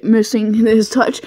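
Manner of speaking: ordinary delivery, nothing marked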